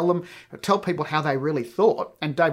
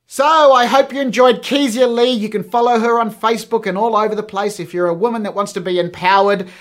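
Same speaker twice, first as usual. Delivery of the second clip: high, loud, upbeat host sign-off; podcast outro; 7 semitones higher, 9 dB louder than usual. Real, not acted. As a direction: project brightly to the listeners, well above chat level.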